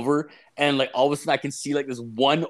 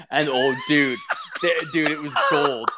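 higher, delighted talk through laughter; laughing; 4 semitones higher, 3 dB louder than usual. Real, not acted. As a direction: relish the story, laughing as you talk. Laughing at own story of the quarrel.

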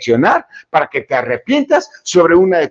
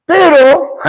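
emphatic exclamation; 11 semitones higher, 6 dB louder than usual. Real, not acted. Dramatic turn in the lesson.

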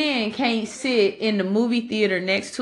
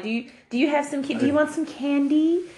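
high, fast, sugary creep voice; sweet creepy coaxing; 6 semitones higher than usual. Retold or imitated. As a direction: coax like a creepy stranger.

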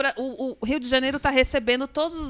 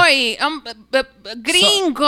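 hesitant gringo; 4 dB louder, much slower than usual. Retold or imitated. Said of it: "ele podia ter falado".

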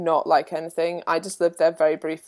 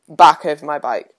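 usual speaking voice

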